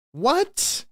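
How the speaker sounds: a high, rising what of shock; stunned what. Real, not acted. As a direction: total disbelief. Disbelief at the clip.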